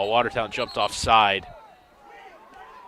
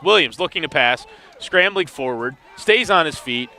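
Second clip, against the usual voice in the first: quicker, louder play call; live play-by-play; 4 semitones higher, 5 dB louder than usual. Real, not acted. Announcer calling the pass to listeners: live play-by-play. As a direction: call the action as it happens.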